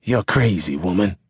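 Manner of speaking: understated angry hiss; hissed rebuke. Acted. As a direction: hiss it at the woman, held down. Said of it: "Mister Barron hissed".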